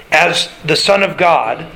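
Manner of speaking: ordinary talk, nothing marked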